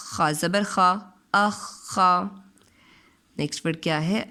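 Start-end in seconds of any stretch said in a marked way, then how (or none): 1.4-2.4 "अखा" stretched very slowly with a held ख; very drawn-out demo. Shown as a demonstration. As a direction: hold the ख long. ("अखा" को बहुत धीरे, ख दबाकर)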